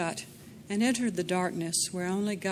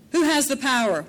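menacing; 7 semitones higher, 7 dB louder than usual. A narrator's reading. Thug demands of Agnes.

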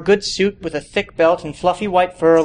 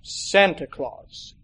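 put-on slow, soft old man's answer; lightly voiced Santa, slow and soft; weary Santa; 3 semitones higher, 4 dB quieter, much slower than usual. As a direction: say it plainly and tired, very slow.

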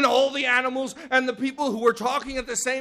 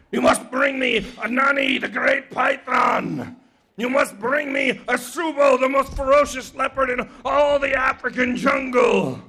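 rough, stretched-out godly command; imposing sky god; 4 semitones higher, 4 dB louder than usual. Acted.

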